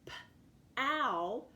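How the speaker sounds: "P-ow" split into puffed p and long ow; sounded out. Shown as a demonstration. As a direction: sound out "P-ow" with a gap after the p. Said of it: "pow is the first syllable".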